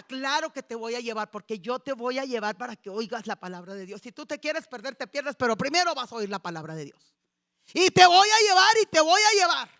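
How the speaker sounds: loud, firm insistence; rising to a loud final vow; insistent mother. Retold or imitated. Mother loudly insisting she will take her daughter.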